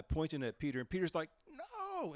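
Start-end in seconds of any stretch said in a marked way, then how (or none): 1.5-2.1 demonstrative denial. Retold or imitated. Peter's statue refusing the servant girl, demonstrative denial. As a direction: stretch the refusal out, pitched way up. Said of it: "in this, this demonstrative way".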